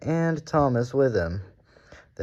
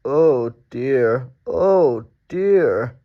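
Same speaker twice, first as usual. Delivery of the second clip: sleepy yawning groan; 4 semitones higher, 8 dB louder, much slower than usual. A narrator's reading. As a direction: drag it out like a big yawn. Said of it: "he yawned".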